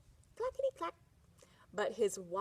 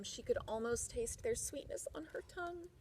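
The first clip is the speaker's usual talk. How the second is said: ordinary delivery, nothing marked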